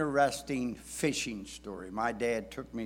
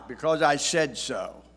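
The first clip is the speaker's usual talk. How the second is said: stern dad voice; 2 semitones higher, 5 dB louder than usual. Retold or imitated. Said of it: "words that many dads have said".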